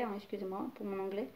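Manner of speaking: ordinary talk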